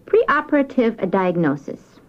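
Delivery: ordinary talk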